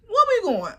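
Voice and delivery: baffled cry at the distance; high-pitched bewildered question; alarmed question. Retold or imitated. Surprised at long drive.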